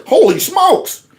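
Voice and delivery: high, slow drawn-out gasp of disbelief; mock amazement. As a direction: stretch it up like he can't believe it. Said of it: "That's probably why he would say".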